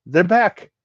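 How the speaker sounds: high, loud alarmed exclamation; exclaimed. Acted. Ward warns everyone the creatures have returned, loud and high. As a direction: cry out the warning. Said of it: "Ward exclaimed".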